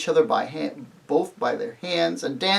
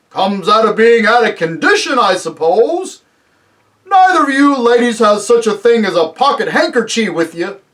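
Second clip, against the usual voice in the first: loud, raised giant voice; booming giant; 7 semitones higher, 9 dB louder than usual. Acted. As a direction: boom it down to the little ladies. Giant asks ladies for a handkerchief.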